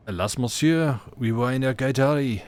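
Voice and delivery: lightly put-on French accent, pleading; beseeching in a hammy French accent; bad French accent. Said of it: "I explained badly in my accent".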